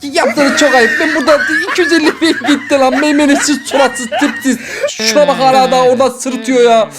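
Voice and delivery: laughing complaint. Real, not acted. Audience laughs at his loss.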